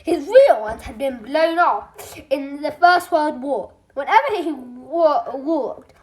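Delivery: ordinary delivery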